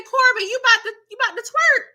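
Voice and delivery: high loud shocked tease; shocked teasing. Retold or imitated. Yelling at Corbin on the pole, high shocked tease. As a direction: holler at him in disbelief. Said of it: "I said".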